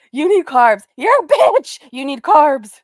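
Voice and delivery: loud mock yell of her insults; imitating her. Commentator loudly imitating the streamer's drunk insults. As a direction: shout it back imitating her. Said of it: "that was my favorite part".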